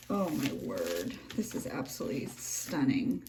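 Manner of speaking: low, soft amazement; hushed awe. Real, not acted. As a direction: drop low and soft, overwhelmed by beauty. Awe at handmade journal.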